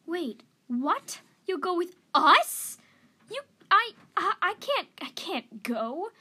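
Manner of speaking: high, loud, stammering disbelief; flustered, climbing then falling back; startled young cat. A narrator's reading. Young cat told the elder will come, startled and stammering.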